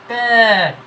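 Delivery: "ত্যাগ" as taeg, long loud bent ae; bent vowel. Shown as a demonstration. Teacher showing the bent sound, "ত্যাগ" drawn out as taeg. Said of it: "একটু বেঁকে যে".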